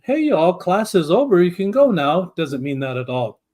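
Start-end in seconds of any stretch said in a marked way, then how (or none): 0.0-2.2 casual dismissal. Retold or imitated.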